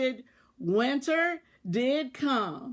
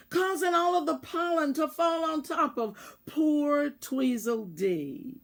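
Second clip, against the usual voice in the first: ordinary delivery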